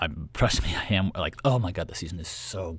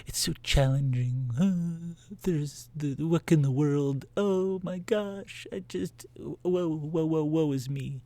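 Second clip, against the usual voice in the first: a higher, slower, hammed-up lament over the challenge; melodramatic woe; 8 semitones higher, slower than usual. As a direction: sigh out woe is me like a tragic heroine, slowly and in mock despair. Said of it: "there are times where I go".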